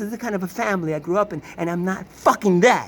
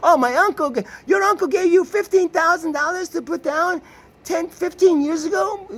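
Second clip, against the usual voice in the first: mock sister; 11 semitones higher, 4 dB louder than usual. Sister's answer, mocked.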